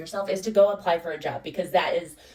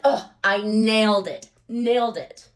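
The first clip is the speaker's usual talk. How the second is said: slow, louder, cocky and pleased with herself; smug self-praise; 5 dB louder, slower than usual. Retold or imitated. To herself after the interview, slow cocky gloating. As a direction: gloat like the job is already hers.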